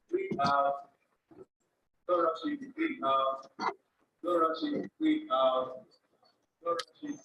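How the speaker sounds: calm, soft instructor guiding breath; soft spa whisper. Acted. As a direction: guide the breathing gently. Leads a slow breathing exercise.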